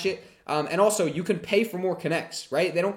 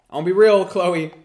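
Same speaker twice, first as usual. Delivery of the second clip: much louder, punchy call to Chloe; 4 semitones higher, 11 dB louder, slower than usual. Real, not acted. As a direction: call her out across the room. Calling out chat member Chloe.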